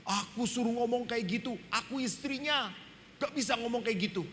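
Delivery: a hint of an annoyed old husband; father's mild protest. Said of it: "Bapak saya marah pada saya".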